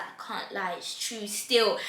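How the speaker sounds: very low, soft mumble; sheepish admission. Retold or imitated. Jacob admitting it to the best friend, sheepish. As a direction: imitate a guilty man owning up quietly.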